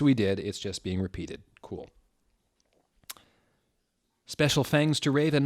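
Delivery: normal talking voice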